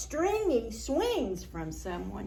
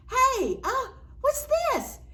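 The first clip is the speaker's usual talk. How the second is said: high, squeaky curious voice; curious cat voice; 13 semitones higher, 4 dB louder than usual. Pretend. Cat's voice, excited discovery.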